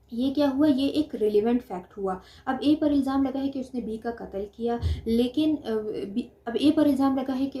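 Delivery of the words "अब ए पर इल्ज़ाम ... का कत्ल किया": normal talking voice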